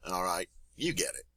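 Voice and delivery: normal talking voice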